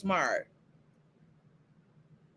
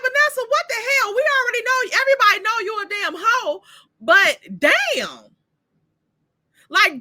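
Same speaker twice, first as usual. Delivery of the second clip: scolding friend voice; 9 semitones higher than usual. Retold or imitated. Anastasia scolding Vanessa over breakfast with Joey, high sassy rant. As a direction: tell her off like an outraged girlfriend.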